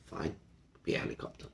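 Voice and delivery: breathy whispered "fine, be a helicopter"; whispered shrug. Pretend. Rabbi plays the shrugging bystander, whispered. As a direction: dismiss it under your breath. Shrugging reply to mock claim.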